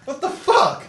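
high, loud, laughing exclamation; giddy shock. Real, not acted. Friend reacting to smoke from the can, giddy shock. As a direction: cry out in amused disbelief.

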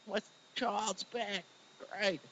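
soft, slow and tender, a father overjoyed. Acted. Preacher voicing the father welcoming his lost son, soft and tender. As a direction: breathe it gently like a father welcoming his child home.

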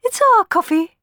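very high, loud squeal; spoilt sister. Acted. Tisby guarding the coffee from the beggar, high squeal. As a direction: whine it like a greedy child. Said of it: "added Tisby".

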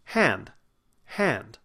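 "Hand" with a wide, resonant open vowel. Shown as a demonstration. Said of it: "Open that mouth".

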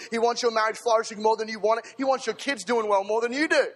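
loud, rising declarations; full-force preaching. Real, not acted. Preacher rallying the church, preaching hard. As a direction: build the encouragement up.

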